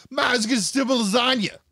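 high-pitched mock shout; imitated outcry. Imitating TikTok guy for co-host, high mock shout. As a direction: cry out as the indignant TikTok guy. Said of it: "This guy was like".

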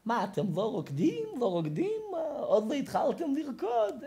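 high, swooping scolding rabbi; old preacher's excited high-pitched questioning; imitated rabbi preaching. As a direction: chide the crowd in a high, animated voice. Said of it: "ככה עם המקל שלו".

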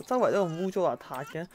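usual speaking voice

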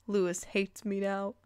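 ordinary talk, nothing marked

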